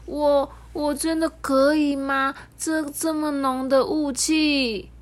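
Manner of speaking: high, slow, hesitant stammer; little train unsure of itself in fog; uneasy, unsure. Acted. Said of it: "很不安欸".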